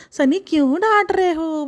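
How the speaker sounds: high, slow, stretched-out whine; mocking whiny wife. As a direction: exaggerate her hurt complaint for the listeners. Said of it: "तो आप भी सुनो". (रिरियाते हुए बीवी की नकल)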